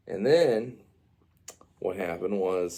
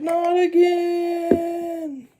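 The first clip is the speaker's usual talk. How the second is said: very high, loud, drawn-out cry; mock falling wail; 5 dB louder, much slower than usual. Storyteller repeating Yoshi's fall, high stretched wail. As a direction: wail it long as if plummeting.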